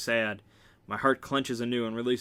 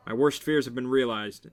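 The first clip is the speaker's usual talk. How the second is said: ordinary delivery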